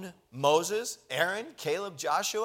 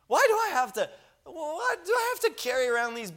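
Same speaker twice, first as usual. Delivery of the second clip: much higher, louder fed-up whine; exasperated whine; 12 semitones higher, 3 dB louder, faster than usual. Retold or imitated. Moses whining to God about babysitting the people. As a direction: throw up your hands, exasperated.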